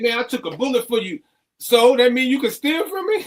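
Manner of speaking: high, fast, indignant voice; outraged friend quote. Retold or imitated. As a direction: fire it back in disbelief. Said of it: "he said".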